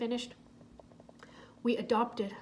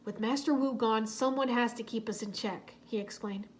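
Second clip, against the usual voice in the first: plain reading voice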